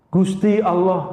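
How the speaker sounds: Gusti Allah in Javanese style, Allah said like aloh, a bit slow; Javanese pronunciation. Shown as a demonstration. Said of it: "orang Jawa nyebutnya Gusti Allah".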